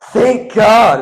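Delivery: high, loud burst of relief. Acted. Supervisor relieved someone finally knows, loud and relieved. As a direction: cry out in mock relief.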